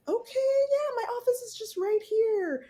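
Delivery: very high, held sing-song cheeriness, imitated; mock chirpy nurse. Imitating head nurse inviting patient into her office, chirpy. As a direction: put on a sugary high receptionist-style voice.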